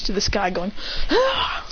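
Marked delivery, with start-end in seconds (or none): 1.1-1.7 high "aah" at normal volume; mock flying yell. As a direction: pretend scream while soaring.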